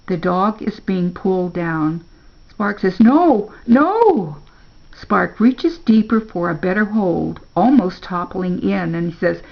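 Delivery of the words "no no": high, loud cries of refusal; desperate protest. A narrator's reading. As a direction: cry out in mounting alarm. Dog being pulled down.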